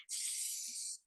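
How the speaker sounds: sustained hissing S; siseo de aire. Shown as a demonstration. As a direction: let air escape in a long S. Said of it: "así como". (siseo de S alargada)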